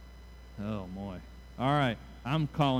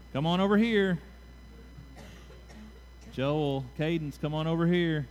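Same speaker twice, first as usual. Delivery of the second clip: calling out; 2 semitones higher than usual. Real, not acted. Calling children forward.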